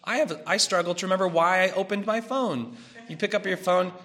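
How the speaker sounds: ordinary talk, nothing marked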